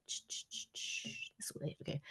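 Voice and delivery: soft unvoiced whisper; whispered aside fixing the camera. Real, not acted. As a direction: mutter it under the breath. Whispered aside while adjusting camera.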